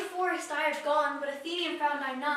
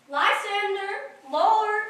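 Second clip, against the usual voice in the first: slow, rising, louder call; 4 semitones higher, 5 dB louder, much slower than usual. Acted. Hermia searching for Lysander, slow rising call. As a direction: call into the dark.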